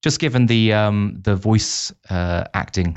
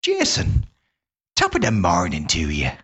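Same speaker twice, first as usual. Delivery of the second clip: a lilting Irish brogue for the show intro; Irish accent. Pretend. Host opens the podcast episode in an Irish accent. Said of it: "the Irish one was 'cause I lived in Ireland".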